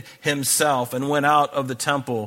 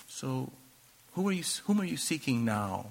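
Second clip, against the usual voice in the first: much softer, almost hushed; quiet, pointed question; 10 dB quieter than usual. Retold or imitated. Jesus to the fallen soldiers, quietly asking again. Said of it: "how he said something".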